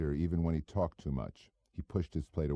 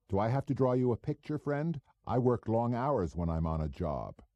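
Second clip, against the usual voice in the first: a hint of a cocky small-time operator; 7 semitones higher than usual. Goebel voiced in dialogue.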